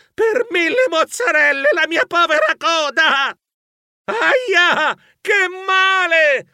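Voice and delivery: high yelping cry of pain, dragged out at the end; pained yell. Acted. Mouse crushed by sliding doors, yelling in pain. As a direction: yowl as the door crushes your tail.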